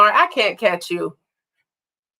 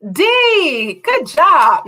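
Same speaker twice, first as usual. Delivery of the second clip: excited praise; 8 semitones higher, 3 dB louder, much slower than usual. Real, not acted. Praising answer.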